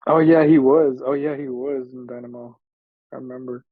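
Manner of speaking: usual speaking voice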